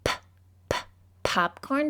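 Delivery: "p, p, popcorn" with puffed separate p sounds; sounding out P. Shown as a demonstration. Teacher sounding out a quiz word for kids. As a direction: puff "p" twice, then say "popcorn".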